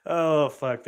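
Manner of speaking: ordinary delivery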